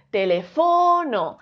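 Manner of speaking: "teléfono" with a long, held FO; slow "teléfono" stressed on FO; stress on third syllable. Shown as a demonstration. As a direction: stretch and hit FO, te-le-FÓÓ-no. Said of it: "Si fuese fo".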